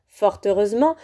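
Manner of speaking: plain reading voice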